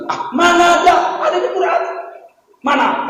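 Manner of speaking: high, disbelieving question; incredulous doubt. Retold or imitated. Friends to the preacher, doubting the Quran claim: incredulous high. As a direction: scoff as if it can't be.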